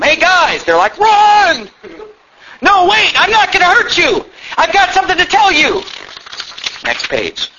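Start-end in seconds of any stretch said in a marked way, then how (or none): 0.0-0.6 high-pitched call across a distance; bully yelling. Acted. Bully calling after the fleeing kids, acted yell. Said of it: "the bully's yelling to him". 1.0-1.7 high, drawn-out mock scream; panicked yell. Kids fleeing the bully, hammed-up yell. 2.6-5.8 much higher, hammed-up calling out; pleading call. Bully calling to fleeing kids, earnest mock plea.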